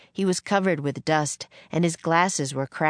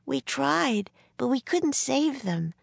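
put-on much higher, sorry little-girl voice; gently consoling; 9 semitones higher, slower than usual. A narrator's reading. Annie comforts Jack over lost books, gentle and high.

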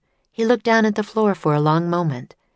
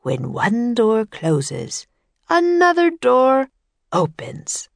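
dreamy slow wisdom; 8 semitones higher, slower than usual. A narrator's reading. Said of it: "He closed his eyes".

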